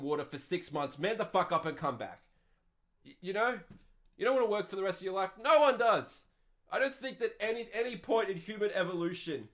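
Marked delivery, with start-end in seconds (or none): none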